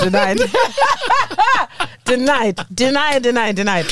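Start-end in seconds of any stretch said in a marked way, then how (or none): 0.4-2.0 high laugh. Real, not acted. Laughing at the joke.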